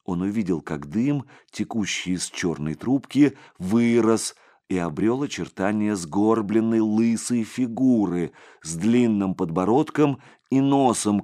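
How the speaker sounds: ordinary delivery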